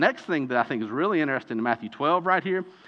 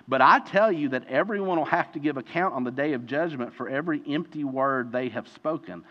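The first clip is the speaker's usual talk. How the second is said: ordinary delivery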